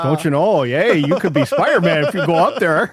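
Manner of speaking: put-on playful Canadian accent teasing a friend. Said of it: "you do a better Canadian accent".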